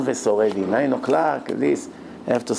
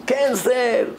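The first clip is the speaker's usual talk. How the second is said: much higher, forceful "cancel"; furious order; 8 semitones higher than usual. Retold or imitated. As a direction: slam down the decision. Grandfather shouting.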